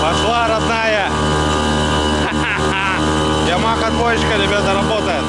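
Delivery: loud over motor noise; shouting over engine. Real, not acted. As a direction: shout above the outboard.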